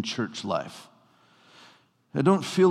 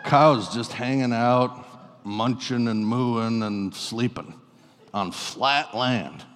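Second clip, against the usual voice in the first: slow, dragged-out droning; mock-boredom, very slow; bored drone; 2 semitones lower, slower than usual. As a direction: stretch each word out as if nothing ever happens. Acting out dull North Dakota scenery.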